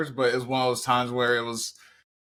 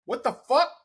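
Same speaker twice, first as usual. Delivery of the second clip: much higher, louder, disbelieving; shocked outburst; 13 semitones higher, 4 dB louder than usual. Retold or imitated. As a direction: shriek it in disbelief. Shocked at mom's softness.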